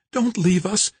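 high, pleading woman's voice. A narrator's reading. Kimberly begs George not to leave, high pleading. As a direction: plead in a high voice, lifting at the end. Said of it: "Kimberly pleaded".

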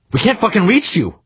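high, loud furious shout; angry bellow. A narrator's reading. Crewman to drifting bleeder, angry bellow. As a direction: bellow it at full pitch. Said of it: "the angry male bellowed".

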